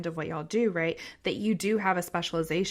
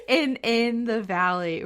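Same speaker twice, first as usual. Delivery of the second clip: breaks into a high laugh mid-phrase; 5 semitones higher, 5 dB louder than usual. Real, not acted. Host laughing while agreeing with the guests. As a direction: laugh your way through the words. Laughing at her own wish.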